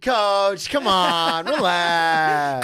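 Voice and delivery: loud drawn-out appeal to calm down; mock pleading. Teammates begging coach to relax, loud mock pleading. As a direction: plead loudly, hammed up.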